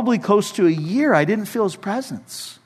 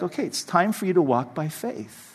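gentle reminder; 8 dB quieter than usual. Retold or imitated. Repeating God's message.

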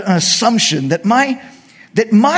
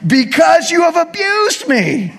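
angry Balaam shout; 9 semitones higher than usual. Acted. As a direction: yell it at the donkey in outrage. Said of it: "And Balaam said to the donkey".

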